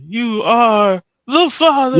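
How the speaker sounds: slow, drawn-out paternity reveal; talk show host. Retold or imitated. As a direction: announce it like Maury. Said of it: "he's gonna be on Murray".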